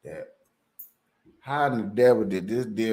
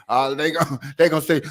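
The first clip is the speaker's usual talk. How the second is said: ordinary talk, nothing marked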